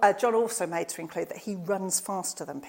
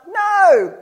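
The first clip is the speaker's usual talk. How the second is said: high, loud shout of no; the fishermen shout back to the shore; 10 semitones higher, 15 dB louder than usual. Retold or imitated. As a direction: yell it back across the water. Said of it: "And they shout".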